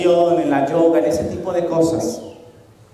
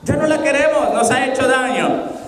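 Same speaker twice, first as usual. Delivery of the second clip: exasperated townsfolk; 9 semitones higher than usual. Retold or imitated. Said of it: "Los de Bet-Semes dijeron".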